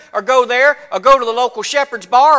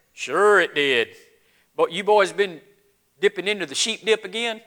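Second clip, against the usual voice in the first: dry, quieter doubting night clerk; skeptical shopkeeper; 6 semitones lower, 6 dB quieter than usual. Acted. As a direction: humor them without believing a word. Shopkeeper doubting the shepherd's story.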